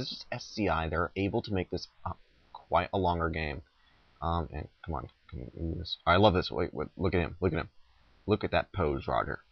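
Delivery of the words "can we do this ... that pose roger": usual speaking voice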